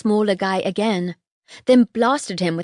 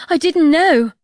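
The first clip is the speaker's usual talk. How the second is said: high, loud panicked plea; frightened; 9 semitones higher, 7 dB louder than usual. A narrator's reading. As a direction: plead in a panic. Frightened boy pleads.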